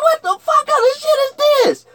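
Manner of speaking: shouted high and loud in disbelief; mock outrage, screamed high; outraged shout. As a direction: yell it like you can't believe it. Outraged reaction to bad fight.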